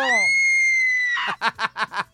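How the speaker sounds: very high, loud shrieking laugh; giddy squeal of laughter. Real, not acted. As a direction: let a wild laugh burst out. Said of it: "me volví loco".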